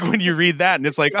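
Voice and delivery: normal talking voice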